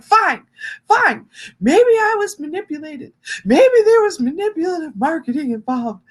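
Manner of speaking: high, frantic whine of self-blame; whiny self-blaming self. Retold or imitated. To her therapist, mock self-blaming whine pitched high. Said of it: "You know what I sound like".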